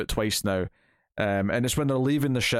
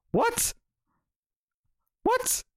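much higher, disbelieving double what; incredulous what. Retold or imitated. Reviewer reacting to a weird comic line, high incredulous squeak.

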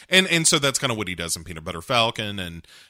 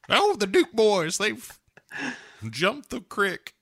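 high, slow Southern yokel voice; over-the-top Southern accent with a laugh; hammy Southern accent; 11 semitones higher, slower than usual. Retold or imitated. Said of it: "that kind of Southern accent".

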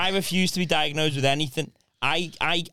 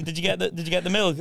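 normal talking voice

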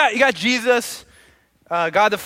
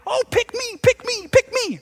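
high-pitched eager pleading; amped excited kids; 3 dB louder, slower than usual. Acted. Kids want to go first at kickball, excited high pleading. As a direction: squeal it like preschoolers begging to be chosen. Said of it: "they're excited and they're amped".

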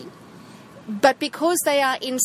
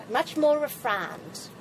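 slow mock-refined South African English accent; much slower than usual. Shown as a demonstration. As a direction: put on a lightly posh English accent. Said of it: "and the English accent".

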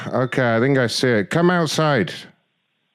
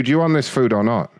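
ordinary talk, nothing marked

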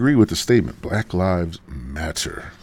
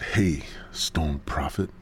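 whisper; 3 semitones lower, 7 dB quieter, slower than usual. Retold or imitated. God greets the narrator.

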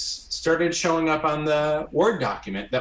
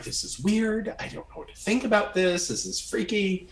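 higher, hushed, spooked inner voice; weirded out; 7 semitones higher, 4 dB quieter than usual. Retold or imitated. Guest recalling a psychic's eerie reading, higher and hushed. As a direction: mutter it like it's creeping you out. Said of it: "but I also was like".

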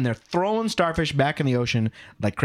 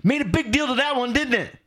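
put-on proud little kid; 10 semitones higher than usual. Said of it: "looks up at the old man and says".

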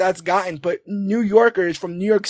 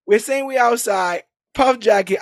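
ordinary talk

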